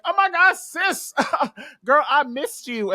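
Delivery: high-pitched gushing greeting to a sister; excited reunion. Retold or imitated. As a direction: squeal it, then stretch the words out with delight. Jean greets her friend in retelling.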